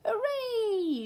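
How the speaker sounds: high cheer. A narrator's reading. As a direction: whoop with delight. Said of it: "shouted Timothy".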